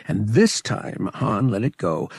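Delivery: ordinary delivery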